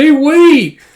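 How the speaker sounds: high, loud call; alarmed protest. Acted. Captain's subordinate protesting refusal, alarmed. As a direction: cry out to stop them. Yuri protests being told no.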